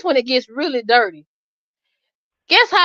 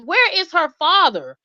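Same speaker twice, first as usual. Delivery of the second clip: incredulous questioning; 5 semitones higher than usual. Retold or imitated. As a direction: build to indignant disbelief. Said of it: "I'm like".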